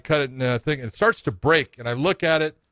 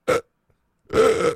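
strained, higher, louder grunting gasps; gasping for breath; 12 semitones higher, 4 dB louder than usual. Retold or imitated. Retelling his fall, gasping for air in short bursts. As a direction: mimic fighting to pull a breath in.

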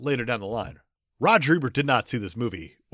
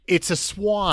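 higher-pitched dismissive quote; 5 semitones higher, slower than usual. Acted. Co-host imitating the swan's owner brushing off police, dismissive. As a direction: brush it off as obvious. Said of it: "I just like how dismissive he is".

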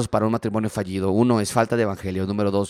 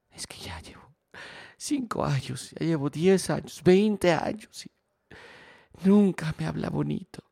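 whispered start rising into a high whiny complaint; whiny victim; 7 semitones higher than usual. Retold or imitated. Victimized spouse complaining about years of hurt, whiny and higher. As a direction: play the self-pitying spouse, whining.

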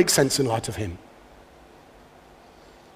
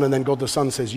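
usual speaking voice

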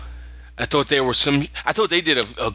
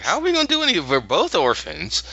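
put-on whiny kid voice; 7 semitones higher, faster than usual. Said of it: "Roger's like".

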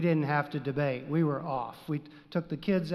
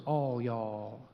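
"all y'all" much lower, softer, Texas drawl; Southern all y'all; 7 semitones lower, 4 dB quieter than usual. Shown as a demonstration. Preacher to his congregation, joking about the bigger plural in a low drawl. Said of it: "Some people say the plural is".

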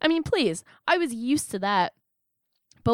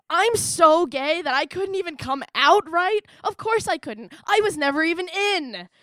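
exasperated rant; 6 semitones higher than usual. Acted. Daughter realizing she was never closeted, comically exasperated. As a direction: stretch it out in theatrical outrage.

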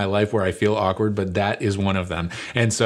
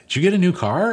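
high, loud excited question; excited girl; 10 semitones higher, 4 dB louder than usual. Retold or imitated. Daughter greeting her parents, high and excited. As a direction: squeal it at the parents.

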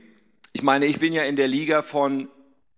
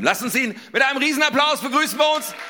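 high, loud shouted welcome; rousing stage introduction; 13 semitones higher, 4 dB louder than usual. Real, not acted. Host calls the congregation to applaud the guest preacher, rousing shout. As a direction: whip up applause with a big shout.

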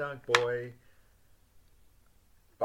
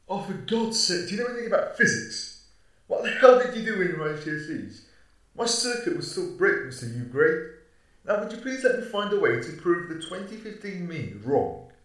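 exasperated rant; 2 semitones higher, 7 dB louder than usual. Acted. Exasperated outburst at partner.

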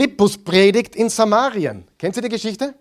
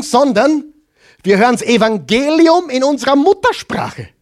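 higher, driving sermon voice; fervent preaching; 5 semitones higher than usual. Acted. Preacher stirs the congregation about the miracle, fervently. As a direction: preach it with building conviction.

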